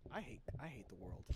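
soft whispered weary complaint. Real, not acted. Friend grumbling under his breath, a soft weary whisper. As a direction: breathe it out, fed up. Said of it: "sighs dude".

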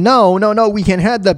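ordinary talk

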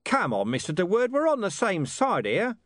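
high-pitched, cajoling commander; coaxing police commander. Acted. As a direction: coax a reluctant witness.

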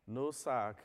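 normal talking voice

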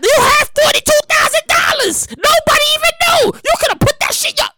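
high-pitched disbelieving holler; incredulous high yelling; exasperated scolding. Acted. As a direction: scold at the top of your range.

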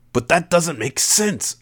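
high, slow, exasperated protest; exclaimed protest. A narrator's reading. Narrator arguing with Sajin, exclaiming. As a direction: protest it, voice shooting up. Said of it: "I exclaimed".

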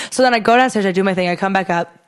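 ordinary delivery

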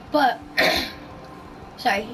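choking on a cough; strained throat, choking a little. Real, not acted. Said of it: "I'm choking".

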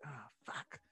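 quiet, sinking curse to oneself; soft, deflated "oh no" groan of a curse; dejected muttered swear. Retold or imitated.

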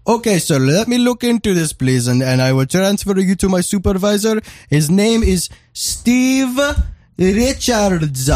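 high-pitched put-on Indian accent; mock Indian agent. Retelling the call, a high mock Indian accent. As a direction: drag out the supervisor's name like the agent did. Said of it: "He was like".

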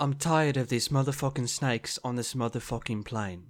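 badly faked British accent, Mrs. Doubtfire style; awful British accent. Shown as a demonstration. Co-host answers the challenge with the movie quote, bad British accent. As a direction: put on a clumsy British accent. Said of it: "you can't do a British accent either".

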